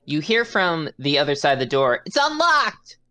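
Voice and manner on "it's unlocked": shouted npc line. Acted. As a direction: call it through the door, high-pitched. GM voices NPC behind door.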